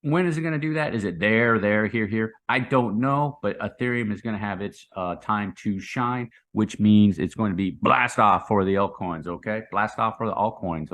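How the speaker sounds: usual speaking voice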